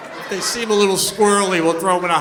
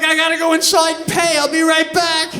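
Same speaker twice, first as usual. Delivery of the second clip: higher, louder hasty reassurance; reassuring owner; 10 semitones higher, 6 dB louder than usual. Retold or imitated. Owner leaving the yelling dog to go pay, high and hasty. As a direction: promise you'll be right back. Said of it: "I'm like".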